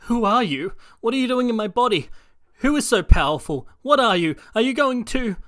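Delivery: high, loud frightened questions; a scared kid firing off questions; panicked Midoriya. Acted. Midoriya questioning the voice in his body, high and panicked. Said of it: "Confused, Midoriya says".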